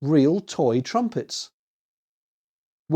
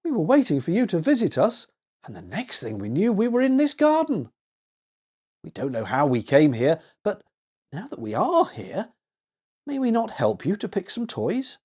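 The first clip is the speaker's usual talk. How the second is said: lightly voiced boy, pitched higher; a small boy making a plan, higher than the narration; eager little boy; 5 semitones higher than usual. A narrator's reading. Willie proposes taking turns to Annie, an eager boy's voice.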